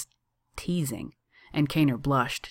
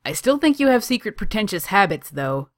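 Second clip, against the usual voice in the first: high, loud call after someone leaving; raised, taunting parting shout; shouted after him; 7 semitones higher, 7 dB louder than usual. A narrator's reading. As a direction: call it out teasingly, easing off at the end. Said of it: "Kaner shouted after him".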